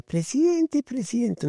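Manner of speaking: put-on very high, small child's call; imitating a small girl calling out to the president; tiny girl's voice. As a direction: squeak it up like a little girl calling. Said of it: "una vocecita chiquita".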